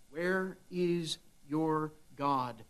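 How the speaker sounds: slow, hushed, sinister taunt; devil taunting. Acted. Devil taunting a suffering believer, slow hushed sneer. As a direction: let it sink in, quietly mocking. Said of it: "what does he say when you suffer".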